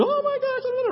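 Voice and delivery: very high squealed gush; gushing women. Retold or imitated. Imitating two women chatting on the phone, a very high gushing squeal. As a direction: shriek it like two friends catching up. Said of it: "And they were".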